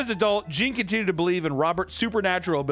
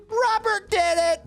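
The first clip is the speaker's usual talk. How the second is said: much higher, louder and slow; childish blame; 5 dB louder, slower than usual. Retold or imitated. Gene blaming the doll for mishaps, high whine.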